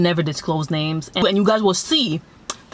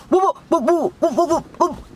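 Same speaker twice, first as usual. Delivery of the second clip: a high sputtering whoop, whoop; spluttering, lost for words; mock stammering; 9 semitones higher than usual. The friend caught out, speechless, in high mock sputter. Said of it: "No words were coming out".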